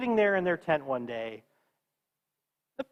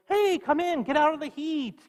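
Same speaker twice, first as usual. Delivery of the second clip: warm welcome; 10 semitones higher than usual. Acted. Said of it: "So they're like".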